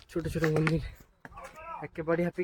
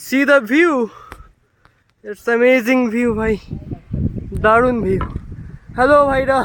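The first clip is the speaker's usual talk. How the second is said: excited; 4 semitones higher, 5 dB louder, slower than usual. Real, not acted. Excited view at summit climb.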